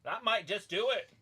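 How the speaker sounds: excited. Real, not acted. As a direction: call it out as the near fall happens. Excited reacting to the match.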